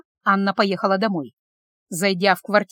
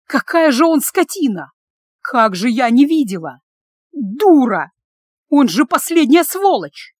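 emotional outburst; 4 dB louder, slower than usual. A narrator's reading. Wife cursing her husband while driving away, angry outburst. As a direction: vent fury in bursts, with sharply raised pitch. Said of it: "Выкрикивала на эмоциях".

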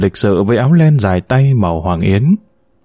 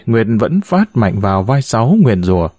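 ordinary delivery, nothing marked

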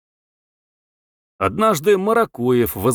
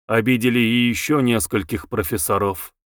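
ordinary delivery, nothing marked

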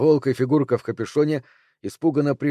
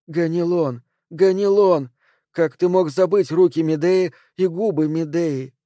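higher-pitched calling, as to a lover; raised, pleading cry of the name; impassioned plea; 5 semitones higher, 3 dB louder than usual. A narrator's reading. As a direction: call out in reproach, pitched up. Spirit voice pleads with sleeper.

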